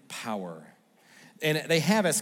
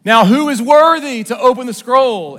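booming announcer call, much higher and louder; stadium announcer; 10 semitones higher, 17 dB louder than usual. Retold or imitated. Stadium announcer introduces Caesar to the crowd, booming call. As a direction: proclaim it to the whole stadium. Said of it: "an announcer would stand beside him and announce".

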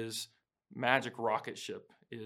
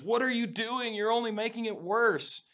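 high, louder mock-panicked protest; panicking Joy; 10 semitones higher, 5 dB louder than usual. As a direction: retell it as a panicked, high-pitched outburst. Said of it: "Joy panics and goes".

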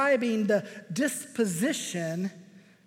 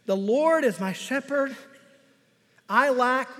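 louder, higher proclamation; building emphasis; 3 semitones higher, 5 dB louder than usual. Acted. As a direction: build the verse up to a question. Builds to a question.

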